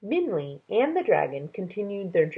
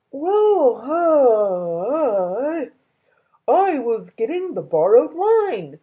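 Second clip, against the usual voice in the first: a much higher, louder dragon voice, flustered; Dragon's voice; 7 semitones higher, 7 dB louder, slower than usual. A narrator's reading. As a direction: stammer it out like a caught young dragon. Dragon answers Minley.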